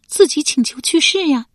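high, loud wifely answer; wife's voice. A narrator's reading. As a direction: pipe up as the wife. Wife answers husband's question.

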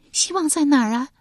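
high, loud female character voice; young woman's voice. A narrator's reading. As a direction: lift into the concubine's voice.

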